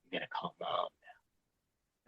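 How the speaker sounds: sudden eerie calm, trailing into a soft, breathy menace; Hannibal Lecter. Retold or imitated. Brother turning chillingly calm to threaten a call to Mom, Hannibal Lecter. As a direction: go icy calm and creepy, like a killer. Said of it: "Silence of the Lambs, you know, Hannibal Lecter".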